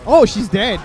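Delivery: loud, high triumphant call; excited shout. Real, not acted. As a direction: call out the kill. Enemy killed mid fight.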